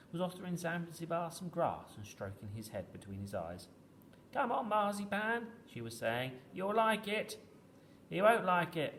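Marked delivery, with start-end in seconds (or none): 4.3-5.5 put-on high, coaxing small child's voice; little niece. A narrator's reading. Little niece coaxing the wooden Zanzibar. 6.5-7.4 put-on high, louder child's coaxing; little niece. A narrator's reading. Little niece coaxing the wooden Zanzibar. 8.1-8.9 loud, raised call to someone below. A narrator's reading. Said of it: "I called down".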